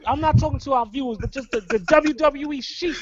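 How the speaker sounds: usual speaking voice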